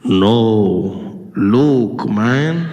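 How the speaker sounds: low, heavy Vader voice sliding toward own; Darth reveal. Acted. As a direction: deliver the reveal deep and slow. Darth's reveal in acted scene.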